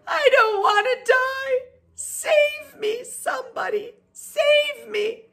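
very high, loud squealing pig; panicked scream. A narrator's reading. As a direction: shriek for rescue in terror. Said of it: "screamed Wilbur".

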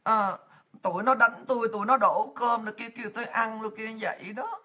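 soft, timid victim voice; meek, hushed complaint to the UN, laughing at the end; meek innocent act. Pretend. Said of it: "[name] giả bộ [name] hiền lành".

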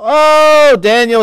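high, loud, pleading call; anxious call. Retold or imitated. The king calls into the lions' den, high loud anxious cry. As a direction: call down into the pit, afraid of the answer.

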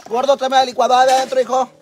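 calling loudly to her son in another room. Real, not acted. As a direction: raise the voice to reach the other room.

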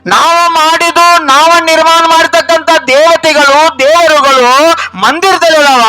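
high, sustained declaiming; impassioned preaching. Real, not acted. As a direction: drive each phrase home to the congregation. Preaches on man-made gods.

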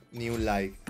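ordinary delivery

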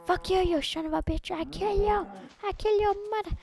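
high, loud hammed-up threats at a monster; mock furious yelling. Yelling at a game mob.

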